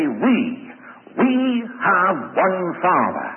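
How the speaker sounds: "We" set apart, repeated and hit loud; stress on We. Shown as a demonstration. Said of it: "we" in there is emphatic".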